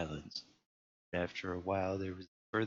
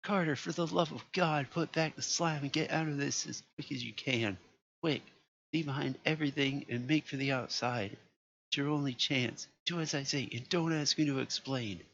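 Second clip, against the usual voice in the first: high, loud, urgent panic; frantic orders to flee; terrified friend shouting warnings; wild consternation; 7 semitones higher, 3 dB louder than usual. A narrator's reading. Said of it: "in a pitch of wilder consternation".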